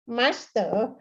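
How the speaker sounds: normal talking voice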